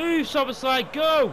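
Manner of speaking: loud, high urging; urgent shout. Real, not acted. Gamer urging his striker on, loud shout. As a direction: yell the player forward.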